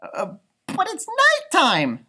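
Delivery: high, squeaky worried voice; a tiny bat squeaking in fear; squeaky little bat. A narrator's reading. Stella Luna squeaks to her mother about flying at night. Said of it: "Stella Luna squeaked".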